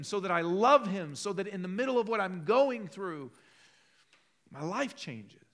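loud, high, impassioned preaching; fervent preaching. Acted. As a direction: press the point home to the congregation. Preaching the psalm.

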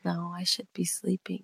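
soft unvoiced whisper. Real, not acted. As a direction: whisper it like someone's asleep. Late-night recording, whispered reply.

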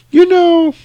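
very high, loud, airy mom voice; put-on high singsong; chipper mom. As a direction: imitate a blissfully upbeat mother. Voices the mom cheerfully reforming.

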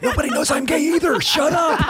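imitating a furious, high-pitched hush; fast, squealed protest as the man about to be outed; mad panic. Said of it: "he got mad".

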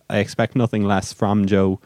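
ordinary talk

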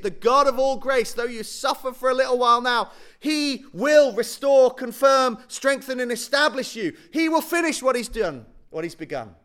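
big revival sermon cadence; preaching at full force. Acted. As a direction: lift the hall with each word of promise. Preacher builds again on grace.

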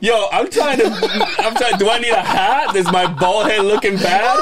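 high, laughing mock plea. Pretending to ask the aunt about a hat, laughing. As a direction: beg through the giggles. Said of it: "I'm like".

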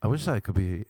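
usual speaking voice

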